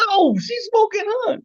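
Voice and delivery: very high, loud, near shriek; squealing. Shown as a demonstration. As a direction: gush over her at full pitch. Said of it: "contrasting pretty with smoking hot".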